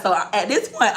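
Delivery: ordinary delivery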